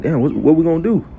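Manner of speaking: high, fast, panicked voice; panicked servant. Retold or imitated. Servant panicking at the surrounding army, high fast alarm. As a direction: play the frightened servant blurting in panic. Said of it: "so he's like".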